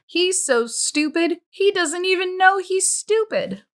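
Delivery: high, scornful jeer; mock him to the other counselors; sneering mockery. Pendanski sneers at Zero.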